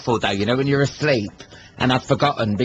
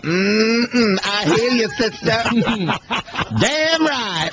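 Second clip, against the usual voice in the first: sassy women in the crowd; 8 semitones higher, much slower than usual. Retold or imitated. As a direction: ham up the crowd calling back to the singer. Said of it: "all these women were like".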